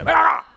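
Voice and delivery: a sudden high yelp of pretend pain; mock pain scream. Preacher acting the pain of a rough hand: mock yelp. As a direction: yelp as if the eye was hit hard.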